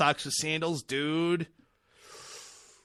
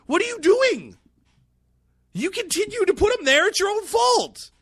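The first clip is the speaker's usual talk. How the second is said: much higher, louder scolding; exasperated rant; 6 dB louder than usual. Real, not acted. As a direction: scold the voters at full pitch. Ranting at Delaware voters.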